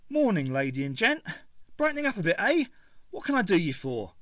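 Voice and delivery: cheery Cockney stallholder touting trade; Cockney stall owner greeting customers; thick Cockney. A narrator's reading. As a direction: rich Cockney, bright and matey. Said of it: "His Cockney accent was as rich and thick".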